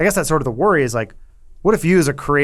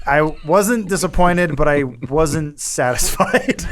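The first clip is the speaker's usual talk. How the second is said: slow deadpan; 2 semitones higher, slower than usual. Real, not acted. Deadpan verdict on the movie.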